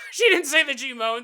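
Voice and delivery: loud laughing retake; laughing shout. Real, not acted. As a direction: burst out laughing mid-line. Laughing repeat of own line.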